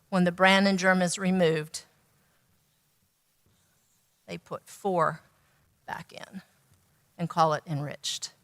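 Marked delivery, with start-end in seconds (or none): 4.3-8.3 softer, almost whispered; hushed reveal. Real, not acted. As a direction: drop to a near whisper. Ironic reveal of four nutrients.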